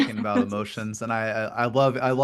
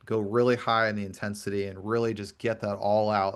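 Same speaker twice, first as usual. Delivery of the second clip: ordinary delivery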